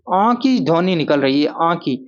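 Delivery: normal talking voice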